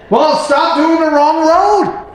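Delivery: high, drawn-out mock exasperation; exasperated retort. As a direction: tell off the clueless driver, stretching it out. Exasperated retort to wrong-way driver.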